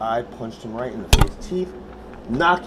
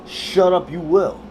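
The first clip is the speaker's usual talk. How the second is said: loud, raised-pitch command; forceful order; 6 semitones higher, 9 dB louder, slower than usual. Retold or imitated. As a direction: order the neighbour firmly, voice up. Threatening the neighbour to be quiet.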